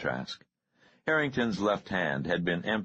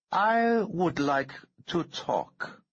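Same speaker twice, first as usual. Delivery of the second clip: high, slow, cold menacing voice; the calm threat of a man holding a bomb trigger; menacing Harrington; 13 semitones higher, 3 dB louder, slower than usual. A narrator's reading. Bomber threatening the senator's aide, slow and menacing.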